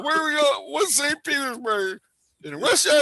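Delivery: high, hammed-up dismayed questioning; mock alarm. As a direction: play the panicked captive asking questions. Acted alarm at being dragged off.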